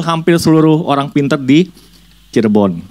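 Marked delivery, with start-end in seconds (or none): none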